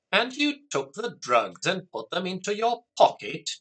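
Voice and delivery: high-pitched, angry, incredulous question. A narrator's reading. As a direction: demand it angrily, pitch pushed up. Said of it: "Jack said angrily".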